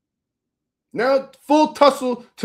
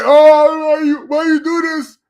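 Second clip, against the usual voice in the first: mocking whine; 8 semitones higher, 5 dB louder than usual. Mocking insecure brothers' whine.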